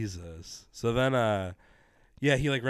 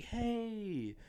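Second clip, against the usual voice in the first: high, soft perky hello; lightly put-on bubbly voice; mock bubbly greeting; 10 semitones higher, 9 dB quieter than usual. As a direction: imitate an over-bubbly waitress. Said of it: "not super, like, bubbly".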